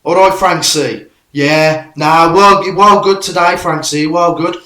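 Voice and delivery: loud, high mock football caller; radio phone-in caller. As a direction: mimic a loud phone-in regular. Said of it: "you get someone ringing up and go".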